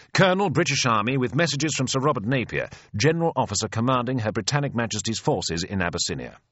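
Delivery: high, rapid military report; Flashy. Acted. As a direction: reel off rank and mission at attention. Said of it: "says I, shoulders back, chin up".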